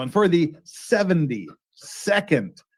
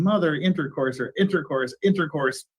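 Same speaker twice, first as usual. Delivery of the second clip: usual speaking voice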